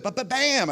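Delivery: high, punchy hammering noise; imitated hammer blows. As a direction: mimic a hammer banging.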